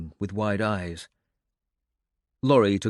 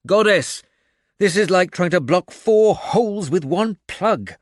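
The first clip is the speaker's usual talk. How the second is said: loud, high frantic outburst; exasperated shout; 8 semitones higher, 6 dB louder than usual. A narrator's reading. A fighter overwhelmed by the battle shouts to the Goddess, frantic and loud.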